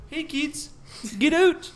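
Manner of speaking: high-pitched mock Canadian promo voice; Canadian accent. Imitating a Canadian kids' promo, put-on Canadian accent. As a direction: chirp it Canadian, punching the end. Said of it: "there's Canadians out there".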